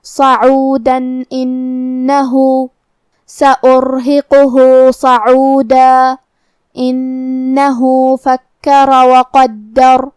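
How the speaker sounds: very slow, drawn-out melodic tajweed; Quran recitation. Shown as a demonstration. Teacher reciting the verse for students, slow melodic tajweed. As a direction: chant each word long and measured.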